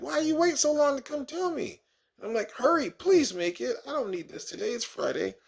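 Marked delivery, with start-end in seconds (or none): 0.0-1.8 mock-exasperated, much higher; a high, strained, comic exasperation. Exasperated teacher with crying kid. 2.5-5.4 frantic pleading. Retold or imitated. Urging kid to bathroom.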